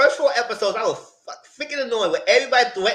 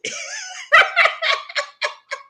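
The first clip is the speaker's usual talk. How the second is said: high laugh. Real, not acted. Laughing at Kimmy.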